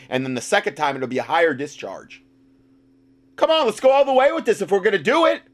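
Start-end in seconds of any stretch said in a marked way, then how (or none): 3.3-5.4 high-pitched mock eagerness; exaggerated, high mock-gung-ho urging; sarcastic. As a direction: egg them on sarcastically, pitch way up. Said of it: "Obviously, I'm being sarcastic".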